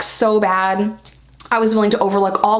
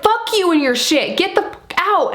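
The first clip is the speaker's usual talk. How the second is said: high-pitched angry outburst at normal volume; retold freak-out; 10 semitones higher than usual. Storyteller reenacting a fight with the rival, heated and high. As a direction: throw the rival out, voice jumping up. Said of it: "freaking out".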